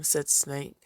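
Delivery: plain reading voice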